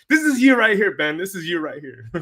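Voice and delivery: high, loud, playful scolding; teasing wife calling Ben out; mock accusing wife. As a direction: point the finger, half joking. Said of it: "wife would say".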